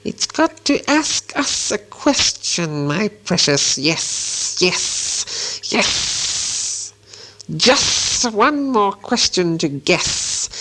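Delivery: high, slow creature voice with long hissing esses; Gollum. A narrator's reading. Gollum demands a question from Bilbo, creepy and hissing. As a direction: draw out every yes into a long hiss. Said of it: "said Gollum".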